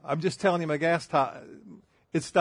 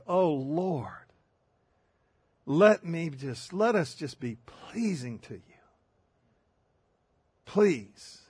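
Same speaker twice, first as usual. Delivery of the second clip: moaning prayer; slower than usual. Retold or imitated. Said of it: "he was moaning, he was sighing".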